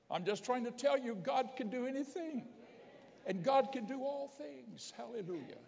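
high, ringing preacher's proclamation; fervent preaching. Real, not acted. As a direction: proclaim it high and full of conviction. Preacher rises to a climax.

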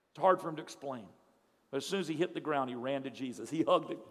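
usual speaking voice

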